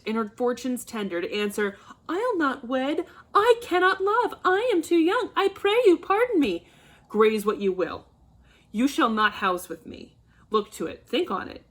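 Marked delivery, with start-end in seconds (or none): 2.1-6.7 high, louder whining mimicry of the daughter; mocking whiny daughter. Father mocking his daughter's refusals, in a high whining voice. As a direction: sneer her excuses back in a high whine. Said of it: "a wretched puling fool, a whining mammet".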